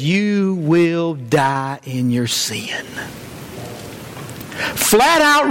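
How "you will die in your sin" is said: drawn out, heavy quote; a solemn warning read slowly. Retold or imitated. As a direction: let each word land slowly, low and grave. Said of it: "And hear me now".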